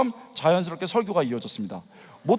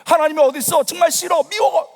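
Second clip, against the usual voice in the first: retold tearful protest; 7 semitones higher, 4 dB louder than usual. Unbeliever telling God he hates him, tearfully defiant. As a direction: pitch climbing high, petulant complaint. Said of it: "막 눈물이 흘러요".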